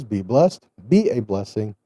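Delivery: usual speaking voice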